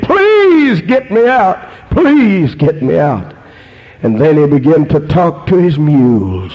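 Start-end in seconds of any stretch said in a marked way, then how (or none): 0.0-3.3 high-pitched desperate plea, easing on the repeat; begging and pleading. Retold or imitated. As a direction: beg for rescue, crying out the first please. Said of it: "He begged and pleaded".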